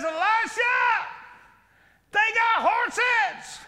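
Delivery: a frightened servant calling his master; panicked servant. Acted. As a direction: servant crying out to Elisha in alarm. Panicked servant voiced in story.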